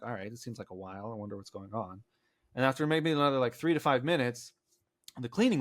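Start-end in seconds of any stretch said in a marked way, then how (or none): 0.0-2.0 murmured to himself, much softer; quiet inner thought. Retold or imitated. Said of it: "I'm like".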